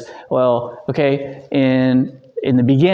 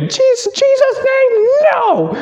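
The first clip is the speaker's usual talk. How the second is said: very high, slow, loud mock shriek; hammed-up squealing rebuke; repeated rebuke; 4 dB louder, slower than usual. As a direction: cry it out again like the teacher. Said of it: "she just kept saying that over and over".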